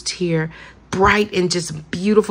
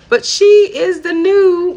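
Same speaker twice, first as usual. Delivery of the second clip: much higher, louder and slow, drawn out in delight; excited gush; 12 semitones higher, 7 dB louder, slower than usual. Real, not acted. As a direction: build it up like a big reveal. Gushing over the new Tinkerbell.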